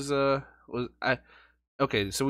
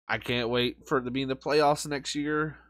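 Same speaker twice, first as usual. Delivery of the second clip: ordinary talk, nothing marked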